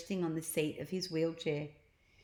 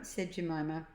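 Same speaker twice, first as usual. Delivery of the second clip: ordinary delivery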